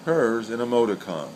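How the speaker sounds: normal talking voice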